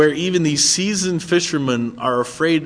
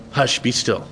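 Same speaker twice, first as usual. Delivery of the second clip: put-on soothing a child; 5 semitones lower than usual. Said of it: "talking to a child".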